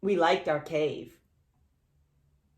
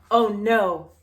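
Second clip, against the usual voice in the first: mock dismay; 2 semitones higher, 8 dB louder than usual. Gasp at tide.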